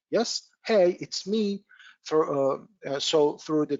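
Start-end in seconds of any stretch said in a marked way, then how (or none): 0.1-1.6 higher-pitched imitation of friends calling out; mild mock shout. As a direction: lightly act out a friend shouting through a door. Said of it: "it's like our friends shouting".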